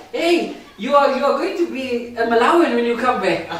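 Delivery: chuckling through the friend's words; laughing quote. Retold or imitated. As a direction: laugh as you pass on his joke. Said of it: "And he says".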